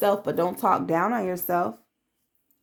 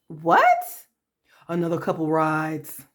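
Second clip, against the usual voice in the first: mock surprise; 4 semitones lower, slower than usual. Mocking the abuser's reaction.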